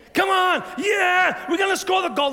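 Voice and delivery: high, loud cheering yell; sports fan shout. Retold or imitated. Fan cheering a goal in the stadium, high loud shout. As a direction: roar like a fan in the stands. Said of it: "You know your sports fan?".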